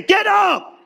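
very high mock yell; mock shout. Preacher mock-yelling at sleeping Jesus, very high shout. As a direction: bark it like a shove. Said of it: "I would have suplexed him".

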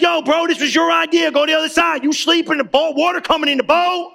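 high, indignant complaint to a sleeping friend; mock exasperation. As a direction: scold him like a buddy who got you into this. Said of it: "I've been like".

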